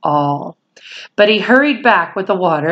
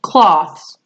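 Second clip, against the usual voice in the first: a hint of a terse order; lightly voiced command. A narrator's reading. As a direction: clip it as a command. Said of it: "he ordered".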